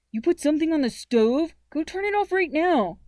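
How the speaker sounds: loud, exasperated big brother; alarmed scolding. Acted. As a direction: scold your little sister urgently. Brother scolds sister over stove.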